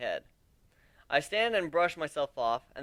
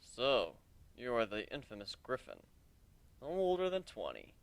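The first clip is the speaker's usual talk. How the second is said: stoic; 4 semitones lower, 7 dB quieter than usual. Acted. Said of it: "he asked stoically".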